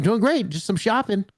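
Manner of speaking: bright, quick small talk; cheerful reply. Retold or imitated. Stranger's cheerful reply quoted.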